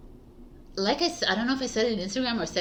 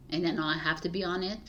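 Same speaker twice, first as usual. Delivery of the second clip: ordinary delivery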